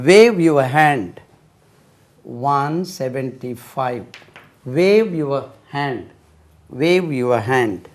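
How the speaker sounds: each word spaced out, very slowly; slow classroom dictation. Shown as a demonstration. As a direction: dictate it word by word for little ones. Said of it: "slow repeated dictation".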